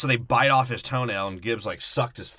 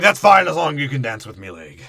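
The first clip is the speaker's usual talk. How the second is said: loud hammed-up pirate; mock pirate voice; 8 dB louder than usual. Podcaster joking as Salazar's crew, mock pirate voice. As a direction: growl it like a cartoon pirate.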